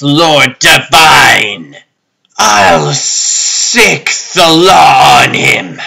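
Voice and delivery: slow, brooding villain's deliberation; a ruler vowing revenge, each word weighed; slow, grave imperious lord; menacing lord. Acted. Lord Divine broods and plots.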